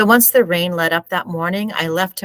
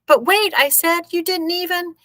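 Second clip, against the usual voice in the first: protesting; 13 semitones higher than usual. Acted. Boy protests being cheated.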